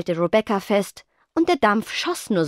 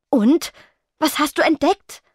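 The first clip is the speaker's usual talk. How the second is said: impatient, louder, higher push; 9 semitones higher, 4 dB louder than usual. A narrator's reading. Rebecca pressing Spectra, impatient and louder. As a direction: hurry her along. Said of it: "fragte Rebecca ungeduldig".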